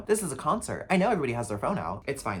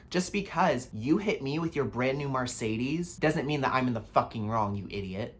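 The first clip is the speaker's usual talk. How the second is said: usual speaking voice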